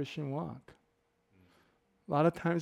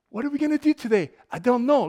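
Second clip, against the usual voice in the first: high, loud, quick explorer voice; imitated eager explorer; 7 semitones higher, 6 dB louder than usual. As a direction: play an explorer up for anything. Voicing the carefree explorer.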